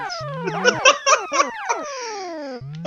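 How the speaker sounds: high, breathless laughter; laughing. Real, not acted. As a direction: laugh helplessly. Laughing hard at story.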